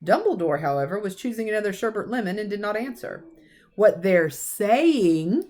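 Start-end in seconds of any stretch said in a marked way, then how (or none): 3.8-5.4 pressing on. Acted. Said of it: "she pressed on".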